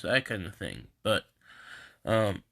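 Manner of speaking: ordinary talk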